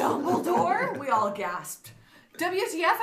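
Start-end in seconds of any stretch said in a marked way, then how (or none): 0.0-1.0 surprised gasp. Acted. Reading gasped quote from fanfic. 2.4-3.0 angry shout. Acted. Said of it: "I shouted angrily".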